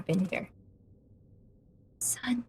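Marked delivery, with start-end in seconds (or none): 2.0-2.4 unvoiced whisper of a single word; a barely audible whispered clue. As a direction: breathe the word with no voice.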